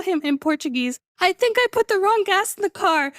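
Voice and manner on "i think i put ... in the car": high, sheepish mock-scared confession; retold fear. Driver telling the attendant in fear, retold scared confession. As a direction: confess it high, acting out dread. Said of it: "with fear in my eyes".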